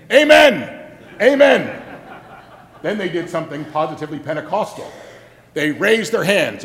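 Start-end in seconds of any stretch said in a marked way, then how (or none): 0.1-1.8 loud, high joyful amen; shouted amen. Retold or imitated. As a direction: call it out like a revival crowd. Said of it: "the people responded in a most un-Presbyterian way".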